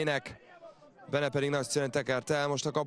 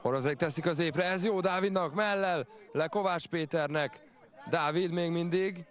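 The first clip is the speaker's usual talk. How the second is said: excited hungarian sports commentary; 6 semitones higher than usual. Real, not acted. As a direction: build up to a shout at the post. Near miss, ball hits post.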